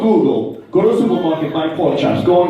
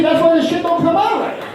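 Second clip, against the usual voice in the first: high-pitched comic indignation; exasperated scolding; 11 semitones higher than usual. Acted. Husband blaming wife's improvising, high exasperated scolding. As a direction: rant it in a high, fed-up voice. Scolding wife over the recipe.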